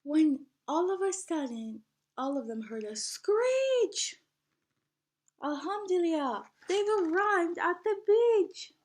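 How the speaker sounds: lively storybook read-aloud; singsong picture book reading; reading to children. Acted. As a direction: read it like story time for a class. Teacher reads picture book aloud.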